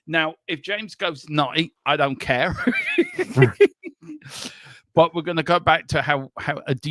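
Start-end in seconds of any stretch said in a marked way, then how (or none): 2.6-4.5 high burst of laughter; short giggling laugh. Real, not acted. Laughs at James going nutty.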